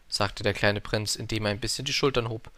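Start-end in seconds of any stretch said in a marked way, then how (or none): none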